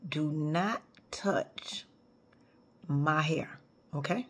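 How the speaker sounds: slow, hushed and low, every word spaced; whispered warning. Real, not acted. Speaker warning the audience about her hair, hushed and slow. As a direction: lean in and warn them in a hush.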